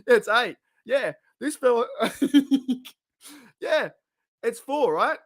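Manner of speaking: high-pitched giggling wrong guesses; laughing mockery. Real, not acted. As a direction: toss out silly numbers while laughing. Mocking more wrong guesses, laughing.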